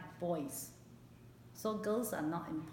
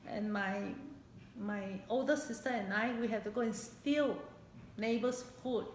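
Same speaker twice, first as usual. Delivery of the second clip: fighting back real tears while remembering hunger; choked up; 2 semitones higher than usual. Real, not acted. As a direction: voice breaking with real emotion. Painful memory of hunger returns.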